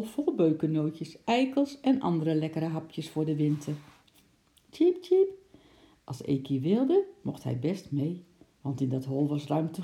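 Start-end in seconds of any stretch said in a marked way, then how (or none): 4.7-5.3 two high, loud cheeps; high-pitched animal peep; squirrel chirp. A narrator's reading. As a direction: squeak like a tiny squirrel. Squirrels chirping to each other.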